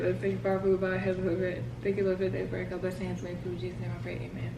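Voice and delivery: soft, murmured table grace; quiet prayer. Real, not acted. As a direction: say grace softly, like praying. Grace said before eating.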